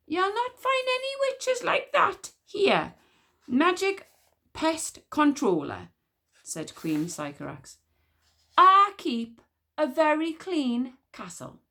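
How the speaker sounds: high, slow regal disdain; haughty queen. Acted. Queen brushes off the witch smeller, high and haughty. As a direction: dismiss him with icy pride.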